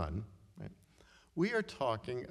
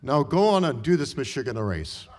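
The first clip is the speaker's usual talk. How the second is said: put-on louder Hungarian-accented command; loud send-off; 3 semitones higher, 12 dB louder than usual. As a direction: push him off to the race, Hungarian accent. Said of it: "in her Hungarian accent".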